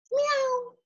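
very high meow; a little kitten mewing; cat meow. Acted. Cat under the bed, high meow.

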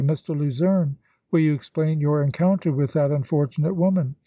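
usual speaking voice

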